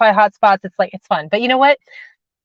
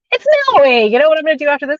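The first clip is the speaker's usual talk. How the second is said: excited self-quote; 6 semitones higher, 4 dB louder, faster than usual. Retold or imitated. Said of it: "then being like".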